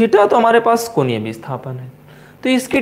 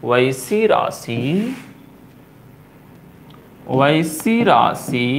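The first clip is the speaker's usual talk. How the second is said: वैसी राशि spoken very slowly and lower; slow dictation; 4 semitones lower, much slower than usual. Shown as a demonstration. Teacher dictating वैसी राशि slowly for notes. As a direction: drag वैसी राशि out so students can write. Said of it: "teacher dictates while writing".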